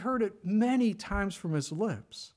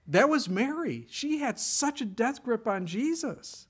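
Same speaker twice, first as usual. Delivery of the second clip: fervent; 7 semitones higher than usual. Real, not acted. Fervent emphatic preaching.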